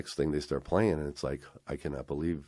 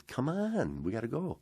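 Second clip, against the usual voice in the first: high, pressing coaxing; imitated eager beckoning; insistent urging; 8 semitones higher, 4 dB quieter than usual. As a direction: hurry a friend along, acting him out. Retold friend insists he come.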